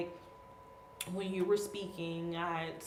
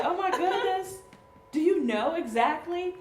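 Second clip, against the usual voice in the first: higher, louder laughing amazement; amazed; 10 semitones higher, 9 dB louder than usual. Retold or imitated. Mother amazed her daughter understood, laughing. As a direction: marvel at the child, laughing. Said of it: "I'm like".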